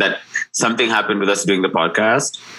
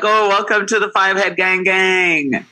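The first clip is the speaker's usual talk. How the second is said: playful greeting; 8 semitones higher, slower than usual. Retold or imitated. Teasing friend about headband.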